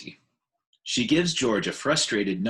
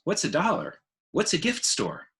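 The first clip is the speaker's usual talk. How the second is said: put-on higher, wide-eyed child's question; curious little elephant; 8 semitones higher than usual. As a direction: ask it like a puzzled little elephant. Ruby the young elephant asks.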